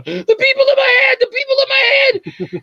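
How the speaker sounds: very high, wailing shout; hammed-up hysterical madman's cry; crazed panic. Acts crazed man running around apartment.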